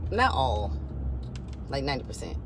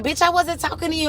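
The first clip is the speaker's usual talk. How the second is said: high-pitched mock holler; retold hollering; 8 semitones higher than usual. Mama hollering back at her, high mock holler. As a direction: imitate an argumentative mother, pitched up. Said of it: "she starts hollering at me".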